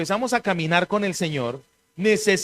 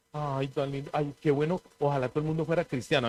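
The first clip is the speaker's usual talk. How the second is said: softer, sing-song imitation of a fawning onlooker; lightly mock the lukewarm admirer; mock admiring; 6 semitones lower, 8 dB quieter than usual. Preacher mimics people who admire from afar, softly mocking. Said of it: "escuchar gente que dice eso".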